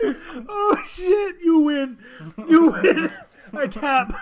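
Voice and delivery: high squeal of laughter; laughing hard. Real, not acted. Host cracking up at the Ravi story, laughing. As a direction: crack up until you nearly cry.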